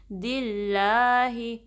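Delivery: دِلَّهِ with a thin lam, tongue kept flat. Shown as a demonstration. Teacher showing students the light lam. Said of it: "لام کو باریک رکھنا ہے".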